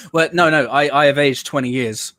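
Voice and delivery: usual speaking voice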